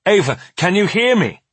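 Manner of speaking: high-pitched urgent calling. A narrator's reading. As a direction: try urgently to rouse an unresponsive patient. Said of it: "he says urgently".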